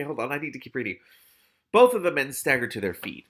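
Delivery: usual speaking voice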